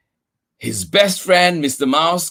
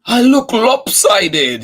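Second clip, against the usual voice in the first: sulky; 10 semitones higher, 7 dB louder than usual. Acted. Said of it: "he said".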